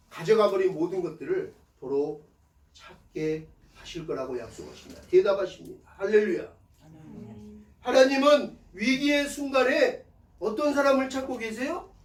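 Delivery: ordinary talk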